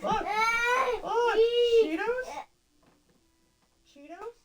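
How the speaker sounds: coaxing high voice. Real, not acted. Parent coaxing crying toddler with Cheetos, high sing-song.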